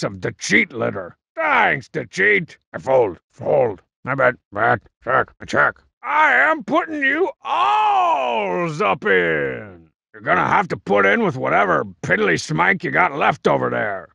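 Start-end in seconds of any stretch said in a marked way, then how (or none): 6.0-9.9 showy shout. Acted. Big all-in announcement.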